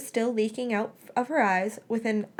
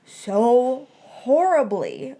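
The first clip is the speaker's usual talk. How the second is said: higher, breaking, slow wail of regret; words breaking into sobs; sobbing confession; 5 semitones higher, 4 dB louder than usual. A narrator's reading. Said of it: "she started sobbing again".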